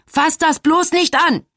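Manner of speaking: high, slow yelled warning; bellowing. A narrator's reading. Mother warning her child off something, bellowing. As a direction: shout each word out, drawn out and high. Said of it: "brüllt sie".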